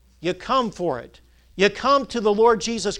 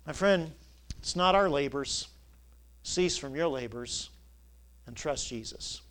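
softer and lower, gently pleading; soft earnest plea; 5 semitones lower, 9 dB quieter than usual. Real, not acted. Preacher gently urging listeners to trust Jesus, softly. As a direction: lean in and coax quietly.

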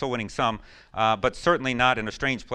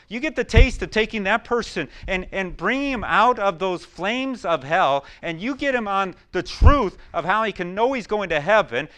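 higher, louder revival preaching; fervent preaching; 9 semitones higher, 6 dB louder than usual. Acted. Pastor urging the church about soul winning, fervent preaching. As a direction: stir the congregation up.

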